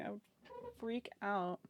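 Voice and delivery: ordinary talk, nothing marked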